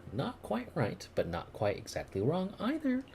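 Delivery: high teasing character voice; playful girl voice. Acted. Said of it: "I didn't voice it that terribly".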